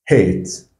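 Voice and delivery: hate said as "het", long A; model word. Shown as a demonstration. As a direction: give hate the English long A sound. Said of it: "pourquoi on a dit ici hate".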